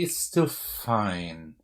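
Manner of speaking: slow, quiet, drawn-out musing; softly dragged reassurance to self. Real, not acted. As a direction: stretch it out while thinking it over. Reconsidering the move aloud.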